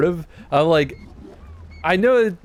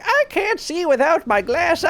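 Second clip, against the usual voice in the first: put-on old witch voice. Quoting witch Matoya.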